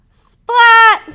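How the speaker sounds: sudden loud high burst of the word; big splat shout. A narrator's reading. Splat leaping into the leaf pile, a loud high shout. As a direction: belt it out like a cat crashing into leaves.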